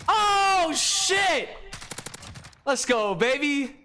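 loud, high victory yell; excited shout. Real, not acted. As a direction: scream with triumph. Just earned the nuke.

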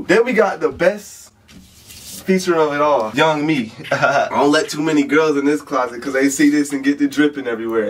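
normal talking voice

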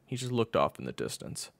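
plain reading voice

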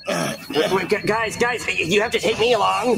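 high, stammering plea; urgent pleading. Acted. Cody begs friends to take him along, high and frantic. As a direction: stumble over the words begging to come. Begging not to be left.

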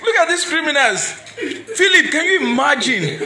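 loud, high talk through laughter; can't stop laughing while talking; helpless laughter. Real, not acted. Host reacting to the prank call, laughing loudly. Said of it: "I can't just stop laughing".

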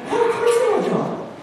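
high, breathy-free squeal of a giddy student; giddy girl. Retold or imitated. Mary gushes to her professor about John, high giddy voice. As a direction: answer like a smitten young woman. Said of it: "she's like".

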